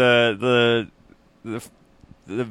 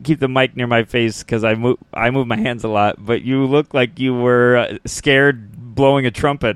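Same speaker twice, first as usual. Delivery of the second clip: normal talking voice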